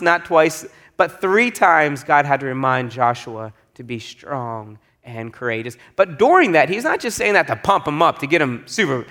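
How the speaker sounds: usual speaking voice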